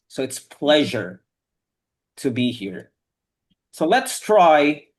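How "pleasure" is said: "pleasure" with a buzzing middle sound, not S; pronunciation demo. Shown as a demonstration. Teacher repeats the word for the class, "pleasure" with a Z-like sound. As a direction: model "pleasure", voicing the s like zh. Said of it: "but not S".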